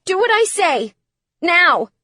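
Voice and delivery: loud, high-pitched command; frantic, forceful insistence; crying out. A narrator's reading. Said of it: "Phoenix cried".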